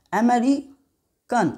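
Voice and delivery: अमेरिकन stretched, with a break before "kan"; syllable breakdown. Shown as a demonstration. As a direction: say अमेरिकन very slowly as "ameri... kan". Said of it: "अन आ रहा है ना". (अमेरिकन धीरे, तोड़कर "अमेरि-कन")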